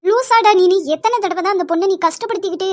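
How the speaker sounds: sharp, high, loud scolding; angry outburst. Acted. Mother scolding her son, loud and angry. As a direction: snap at him in disbelief.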